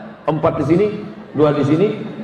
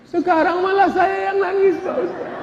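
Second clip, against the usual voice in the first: mock whimper; 13 semitones higher than usual. Father complains he now cries.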